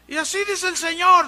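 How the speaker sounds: loud, high prophetic declaration; mock prophecy. Preacher mimics the woman prophesying aloud, loud and high. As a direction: announce it like a prophet taking the floor. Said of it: "decía con el entendimiento".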